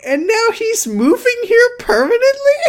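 squealing high in disbelief, laughing through it; near-tearful laughing shock. Real, not acted. Incredulous laughing outburst.

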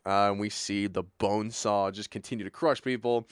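ordinary talk, nothing marked

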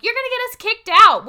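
high, loud panicked scolding of a friend; exclaimed warning, hammed up; mock scolding. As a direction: act out a loud, high telling-off.

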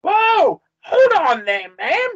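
high, loud halting shout; loud, high-pitched protest; alarmed call. Acted. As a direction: call out to stop her. Calling out to halt angry ghost.

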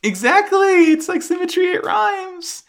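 a giddy high-pitched agreement; squeaky delight. Real, not acted. Cohost thrilled by the callback, squealing high.

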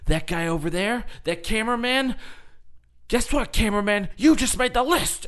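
high, mock-indignant wrestler call-out. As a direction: build to a loud, high taunt. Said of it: "[name] is just, like, he's like".

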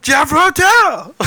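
mock drunk voice. Mimicking the fake drunk voice.

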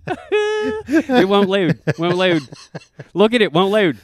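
whiny impression. Imitating the stuck café guy for a friend, drawn-out whine. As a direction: drag it out like a helpless man. Mimics frustrated man at café.

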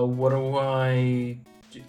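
usual speaking voice

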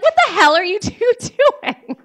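high, loud retold outburst; outraged confrontation. Confronts cheating boyfriend across street.

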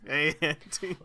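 a loud, high-pitched mock Mario greeting; a hammed-up take on a bad Mario voice; CD-i Mario impression. Cohost opening the podcast with a Mario greeting. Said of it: "my best C D I Mario impression".

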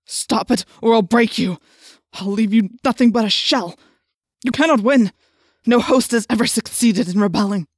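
loud, emphatic threatening; forceful threat. A narrator's reading. The Yeerk threatening its rebellious host, loud and forceful. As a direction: hammer out each threat.

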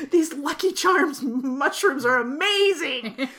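slow, mock dazzled stoner; stoned rave. Mocking the actress as a stoned fan of the script. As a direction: gush like you're high on mushrooms. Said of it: "Jennifer as stoned writer".

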